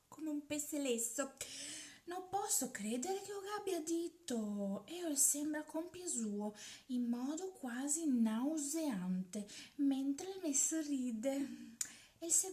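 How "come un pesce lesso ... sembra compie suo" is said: plain reading voice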